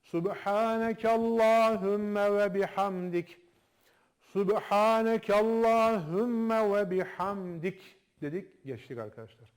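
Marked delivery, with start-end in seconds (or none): 0.0-8.0 slow, chanted Subhanekallahümme, drawn out; group recitation. Shown as a demonstration. Teacher leading the class in slow chanted recitation. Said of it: "Okuyalım toplu".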